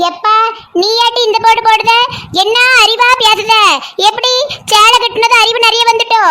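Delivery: scolding. Acted. Acted scolding of the chatterbox. (கோபமாகத் திட்டும் குரல்)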